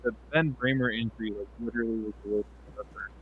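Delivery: muffled, garbled bad mic; underwater mic. Real, not acted. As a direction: sound like you're underwater. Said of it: "You're underwater, buddy".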